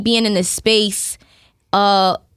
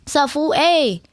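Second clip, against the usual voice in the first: Mexican American accent; 6 semitones higher, slower than usual. Shown as a demonstration. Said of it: "That's Mexican vibes".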